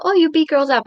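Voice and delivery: ordinary delivery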